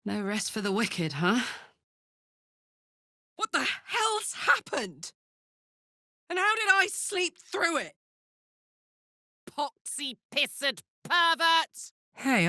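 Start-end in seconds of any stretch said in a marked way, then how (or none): 3.3-7.9 startled shout. Acted. Warrior waking to chaos, shouting in alarm. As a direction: yell it out in shock.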